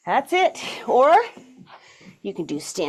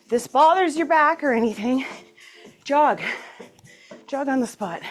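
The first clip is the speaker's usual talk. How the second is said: upbeat fitness coach cues; aerobics instructor; 3 semitones higher than usual. Acted. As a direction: pump up the class. Cheery workout cues.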